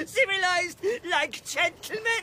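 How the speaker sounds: high, jeering echo through laughter; mocking, high-pitched scorn; derisive mockery. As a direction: scoff at the very idea. Laughing at the call for civility.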